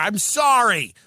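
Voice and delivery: ordinary talk, nothing marked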